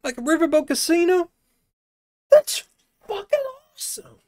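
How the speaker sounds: high thrilled exclamation; excited. Real, not acted. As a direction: gush with excitement. Delighted discovery.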